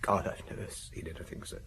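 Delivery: whispered, breath catching on the horror; hushed whisper. Acted. Telling the inspector about the body, in a shaken whisper. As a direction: barely voice it, shaken by the memory. Recalling finding the bloody body.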